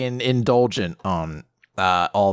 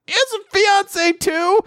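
very high, slow, disbelieving question; a dad floored by the news; astonished dad. Retold or imitated. Dad stunned to hear his son has a fiancée, very high and slow.

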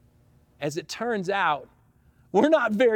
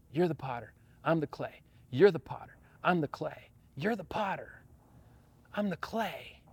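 low, soft repeated surrender; hushed prayer; 6 semitones lower, 11 dB quieter than usual. Real, not acted. Pastor praying to God, soft low surrender. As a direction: breathe it out like a plea.

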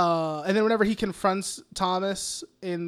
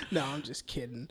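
low, soft deadpan; mock deadpan; 6 semitones lower, 11 dB quieter than usual. Retelling Arthur's joke on Gary, soft deadpan. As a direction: drop it flat and soft. Arthur's quiet switch after scare.

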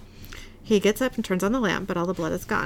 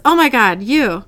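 loud, higher fangirl exclamation; starstruck gushing; 3 semitones higher, 8 dB louder than usual. Acted. Superfan sees her favorite author calling, starstruck gushing. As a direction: gush like a fan meeting an idol.

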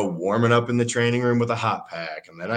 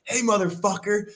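higher-pitched heated challenge; heated shout at a teammate; angry callout; 11 semitones higher than usual. Retold or imitated. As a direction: confront the bully on the field. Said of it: "And I was like".

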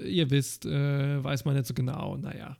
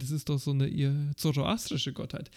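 ordinary talk

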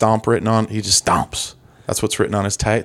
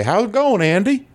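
friendly neighbor greeting; 10 semitones higher than usual. Retold or imitated. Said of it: "pop their head in and say".

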